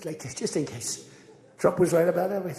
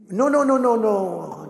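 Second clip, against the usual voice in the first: high, loud, hammed-up panicked refusal; protesting Macron; 7 semitones higher, 9 dB louder, slower than usual. Macron refusing the price demand, high and loud mock protest. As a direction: play the French leader yelping no in alarm.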